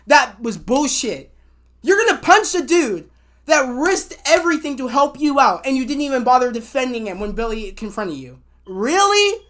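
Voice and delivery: loud, emphatic indignant rant; real exasperated disbelief; pissed off. Real, not acted. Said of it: "[name]'s really pissed, pissed me off".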